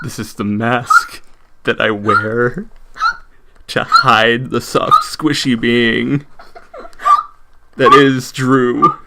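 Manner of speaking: slow, over-enunciated narration, pausing between phrases; enunciated narrator voice. Pretend. Describing the mask that hides the real self, slow narrator voice. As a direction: narrate it grandly, drawing out every phrase. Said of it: "Your stupid, enunciated narrator voice".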